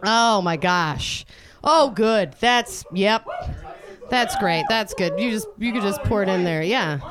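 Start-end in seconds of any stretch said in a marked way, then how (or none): none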